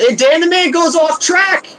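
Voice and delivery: high, loud race call; excited. Real, not acted. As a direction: shout the spin-off like a commentator. Car leaves track in rain.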